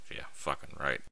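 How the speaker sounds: normal talking voice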